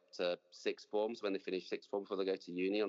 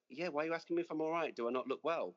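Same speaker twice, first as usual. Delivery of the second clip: mock puzzled Aussie; 8 semitones higher than usual. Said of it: "They always like".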